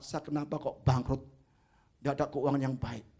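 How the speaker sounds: a mild, low retelling of a tearful complaint; sedih mengadu. Retold or imitated. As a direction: quietly imitate a man close to tears. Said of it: "dia nangis". (suara sedih pelan seperti menahan tangis)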